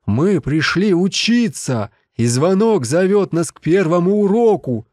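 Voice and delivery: high, slow, thrilled announcement; excited discovery. Acted. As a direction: let the realization ring out. Realization of the library's meaning.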